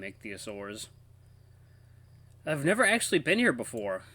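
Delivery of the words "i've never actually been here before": ordinary talk, nothing marked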